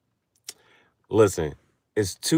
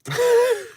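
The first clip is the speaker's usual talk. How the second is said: much higher squealing laugh; shrieking laugh; 5 dB louder than usual. Real, not acted. To viewers at own joke, shrieking laugh. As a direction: crack up in a shriek.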